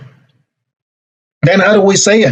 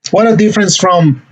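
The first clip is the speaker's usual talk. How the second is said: normal talking voice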